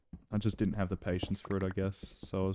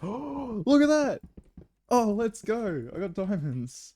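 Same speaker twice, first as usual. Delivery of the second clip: high, drawn-out shout of joy; excited yell; 13 semitones higher, 7 dB louder, slower than usual. Real, not acted. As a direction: yell it out, thrilled at the find. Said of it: "Sorry if I yelled".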